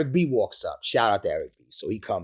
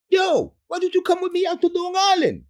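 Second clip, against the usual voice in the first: fast, high, put-on buddy voice; high comic impression of a friend; mock high friend voice; 6 dB louder than usual. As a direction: rattle it off in a squeaky imitation. Friend quoted.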